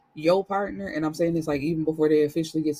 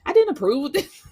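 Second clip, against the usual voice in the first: much higher, louder mock indignation; indignant grandson; 11 semitones higher, 4 dB louder than usual. Grandson objecting to grandma's boyfriend, indignant. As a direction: act scandalized and protective. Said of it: "He like".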